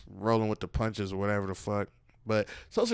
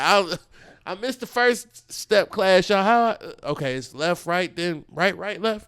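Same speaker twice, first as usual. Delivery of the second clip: higher and louder, counting dance steps in a panic; flustered dancer; 7 semitones higher, 7 dB louder than usual. Pretend. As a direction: fumble the steps out loud like you missed the class. Mimics fumbling the dance steps.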